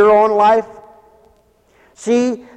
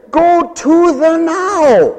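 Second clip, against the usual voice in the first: higher, slow, mocking "Go to the now"; sarcastic; 7 semitones higher, much slower than usual. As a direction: drag it out with scorn. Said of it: "sarcasm in his voice".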